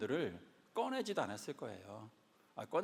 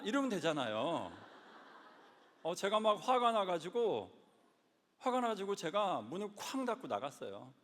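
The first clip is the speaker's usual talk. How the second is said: usual speaking voice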